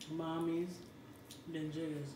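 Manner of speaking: usual speaking voice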